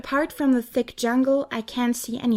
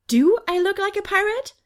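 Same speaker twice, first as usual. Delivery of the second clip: much higher, rising self-question; wondering to herself; 9 semitones higher, 4 dB louder than usual. A narrator's reading. Angel inspecting her own clothes, incredulous. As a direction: ask yourself in disbelief.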